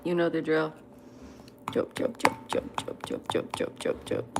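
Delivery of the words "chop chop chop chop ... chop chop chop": whispered chop. Real, not acted. Whispering while chopping noodles.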